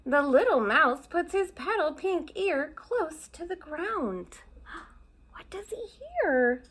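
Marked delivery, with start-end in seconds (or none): 4.7-6.6 hushed, breathy question; suspenseful whisper. Acted. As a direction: whisper to make the kids lean in. Storyteller builds suspense.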